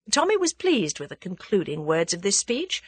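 ordinary delivery, nothing marked

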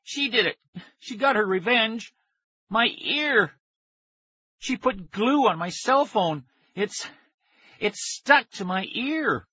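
high, whiny cries of panic; distressed wailing. A narrator's reading. Boy tells how his sister glued the phone to his ear, wailing. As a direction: wail it out, high and aggrieved.

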